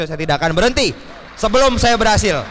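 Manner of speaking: rising to a loud, fast declaration; fast, climbing promise to never quit; determined vow. Retold or imitated. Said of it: "Saya bilang ke Pak Rizky seperti itu".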